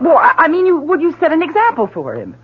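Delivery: scrambling to change the subject; flustered. Acted. Wife deflecting her husband's question, flustered and high.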